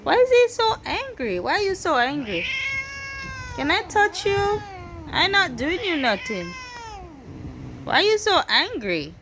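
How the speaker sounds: high, sing-song questions to a pet; coaxing pet talk. Real, not acted. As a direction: plead with a grumpy pet, pitch swooping up. Teasing an angry pet.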